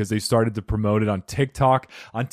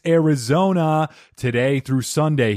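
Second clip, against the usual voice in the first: ordinary talk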